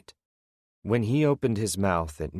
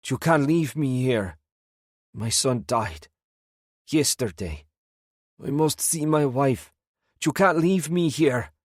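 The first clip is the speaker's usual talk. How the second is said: desperate pleading; 7 semitones higher than usual. A narrator's reading. Old prisoner begs not to be left behind, desperate high pleading. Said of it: "he pleaded".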